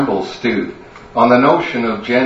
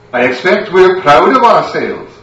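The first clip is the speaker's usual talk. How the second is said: higher, louder, biting sarcasm; sarcastic wife; 7 semitones higher, 6 dB louder than usual. Acted. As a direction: needle him with sarcasm.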